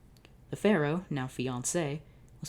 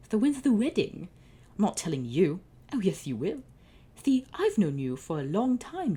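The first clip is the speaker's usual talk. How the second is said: higher, louder sneering taunt; smug villain brother; 6 semitones higher, 4 dB louder than usual. Acted. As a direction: lord it over him like a gloating sibling.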